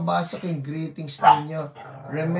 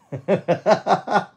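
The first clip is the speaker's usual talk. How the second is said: short, higher-pitched chuckling burst; real amused laugh; 5 semitones higher, 3 dB louder than usual. Real, not acted. Host teasing a viewer with a new number, amused laugh. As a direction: laugh in a quick, bouncy run.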